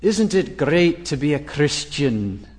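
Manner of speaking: slow imitation of a Scottish pastor; Scottish accent. Preacher quotes an admired pastor to the congregation, slow Scottish accent. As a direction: savour it slowly in a Scottish accent. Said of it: "in his wonderful Scottish accent".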